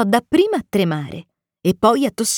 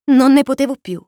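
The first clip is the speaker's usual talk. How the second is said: ordinary delivery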